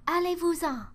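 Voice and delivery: put-on girl yelling up at the rain clouds; shouting at the clouds. A narrator's reading. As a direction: order the rain clouds away. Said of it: "cria aux nuages".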